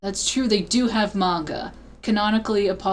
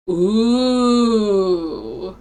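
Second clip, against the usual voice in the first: drawn-out ooh; 4 dB louder than usual. Real, not acted. Reacting to the courtroom move.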